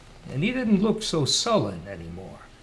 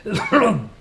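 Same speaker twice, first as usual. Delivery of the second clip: cough-laugh; 9 semitones higher, 4 dB louder than usual. A narrator's reading. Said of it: "took a long drag and coughed".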